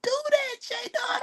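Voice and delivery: hammed-up tiny squeal; mock squeaky whine. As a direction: squeak it out like a wounded friend. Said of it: "I was like".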